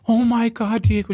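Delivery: high-pitched, thrilled disbelief; excited client. Retold or imitated. Client hearing her credit went up, excited. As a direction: imitate an overjoyed client, voice jumping up high.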